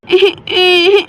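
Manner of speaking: put-on a little boy's sudden rising cry; child crying wail. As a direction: burst into a small child's wail. Little boy sees mother collapsed. (छोटे बच्चे का रोना)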